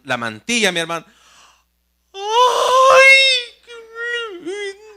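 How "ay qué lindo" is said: much higher, cooing ay qué lindo; gushing over baby. Acted. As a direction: coo over the baby as if melting. Daughter admires the baby.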